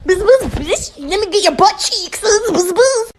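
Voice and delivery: bee voice. Pretend. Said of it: "This bee is just like".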